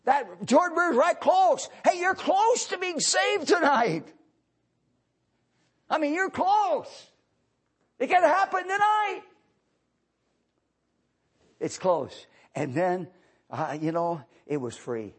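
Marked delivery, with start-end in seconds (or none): 0.1-9.2 loud, high revival preaching; impassioned sermon voice; fiery preaching. Acted. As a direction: preach it out to the crowd. Preaching at full force.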